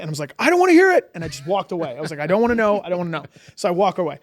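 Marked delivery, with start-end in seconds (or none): 0.4-1.1 much higher, much louder refusal; retold yell. Girl offers to name kid. 2.2-2.8 louder, higher first refusal; firm refusal. Retold or imitated. Walking away, repeats refusal.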